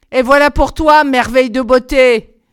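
wicked crone mocking her fallen victim; sneering old woman. A narrator's reading. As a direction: spit it out in cruel triumph. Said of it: "ricana la vieille".

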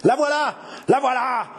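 high, excited shout of discovery; thundered cry. A narrator's reading. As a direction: point out the quarry to a mob. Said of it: "tonnèrent Crucé et Pezou". (cri tonitruant)

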